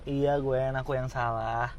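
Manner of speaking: low, soft apology; subdued, low-pitched and gentle; conciliatory admission. Acted. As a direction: concede quietly. Gives in after quarrel.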